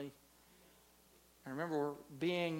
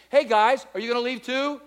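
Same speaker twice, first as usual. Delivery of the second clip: loud call; 7 semitones higher, 16 dB louder than usual. Acted. Jesus voiced calling disciples.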